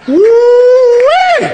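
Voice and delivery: loud high joyful holler; loud high whoop of triumph. Real, not acted. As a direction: holler it out in delight. Celebrating the fight win.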